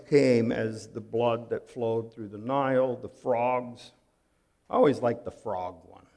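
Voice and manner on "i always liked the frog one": ordinary delivery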